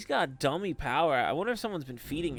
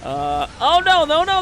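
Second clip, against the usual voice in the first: much higher, much louder panicked cry; alarmed no no no; 12 semitones higher, 11 dB louder than usual. Real, not acted. Caster spotting the revealed ambush, high and loud alarm. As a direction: shout it as the ambush hits. Units suddenly ambushed on screen.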